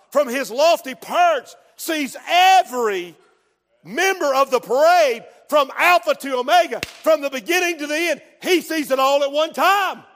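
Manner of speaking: high, loud, emphatic sermon shout; fiery pulpit exhortation; full-force preaching. Real, not acted. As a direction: thunder a warning to the congregation.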